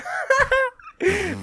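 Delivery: high nasal snort of laughter; snorting laughs. Real, not acted. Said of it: "Fue risa nasal".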